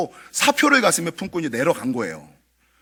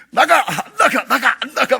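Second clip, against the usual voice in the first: high, loud back-and-forth yelling; retold tug-of-war between pastor and spirit; mock shouting match; 8 semitones higher, 6 dB louder than usual. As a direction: alternate exorcist and ghost shouts. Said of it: "막 이러다가".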